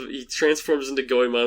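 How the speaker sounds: ordinary delivery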